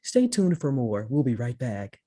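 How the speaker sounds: lightly voiced smooth anchor read; a hint of a professional news read; polished news anchor. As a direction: sound like a steady TV newscaster. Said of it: "They're like, you know".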